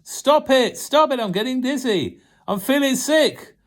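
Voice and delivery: high-pitched dizzy protest; screamed. Acted. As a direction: beg him to stop, dizzy. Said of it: "I screamed".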